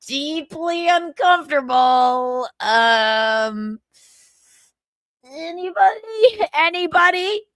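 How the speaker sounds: slow, whiny mock plea; hammed-up helpless call for anybody; pleading, half-crying mock voice; mock distress. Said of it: "He's like".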